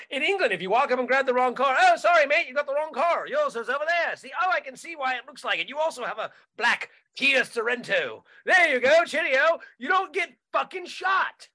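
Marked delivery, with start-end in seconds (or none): 1.8-9.6 breezy put-on English, friendly and apologetic; a chipper Brit pointing out the right car; mock English accent. Englishman helping a stranger who took the wrong car, mock English accent.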